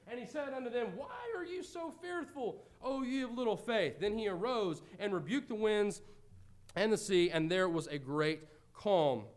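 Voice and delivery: rising, dramatic Bible reading; scripture reading. Acted. Preacher reads the storm passage aloud to the congregation, dramatic.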